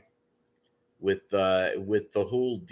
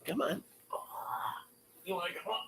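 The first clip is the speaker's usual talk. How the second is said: high, soft coaxing pet voice; talking to a dog; 9 semitones higher, 13 dB quieter, much faster than usual. Real, not acted. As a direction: coax the dog gently.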